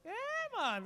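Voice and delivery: normal talking voice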